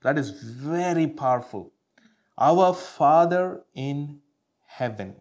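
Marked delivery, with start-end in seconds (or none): 2.4-5.1 scripture reading. Acted. Preacher to congregation, solemn slow scripture proclamation. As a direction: proclaim each word slowly.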